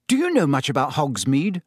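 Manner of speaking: ordinary delivery, nothing marked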